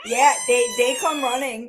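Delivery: usual speaking voice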